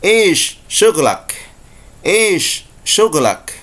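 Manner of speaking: very slow Saudi "ish syughlak"; Saudi Arabic. Shown as a demonstration. Teacher showing students the Saudi dialect phrase. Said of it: "Orang Saudi biasa mengatakan".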